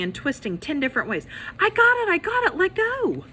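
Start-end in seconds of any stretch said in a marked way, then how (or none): 1.6-3.2 high loud grabbing shout; squealing struggle shout; kids scuffling. Acted. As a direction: yell like a kid fighting for it. Kids fighting over hat.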